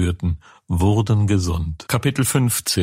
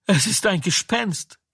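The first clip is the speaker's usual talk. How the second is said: high, startled cry of fear; frightened cry; 9 semitones higher than usual. A narrator's reading. Disciples on the stormy lake, a mild frightened cry. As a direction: gasp it out as if seeing a ghost. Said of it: "Und sie schrien vor Furcht".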